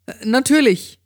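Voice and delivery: very high-pitched friend's reply; Amy. A narrator's reading. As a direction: answer in the friend's high, hesitant voice. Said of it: "entgegnete sie".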